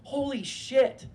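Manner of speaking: drawn-out high gasp of shock; slow, high, reeling holy shit; stunned disbelief. Retold or imitated. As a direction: stretch it out, floored. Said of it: "and I was like".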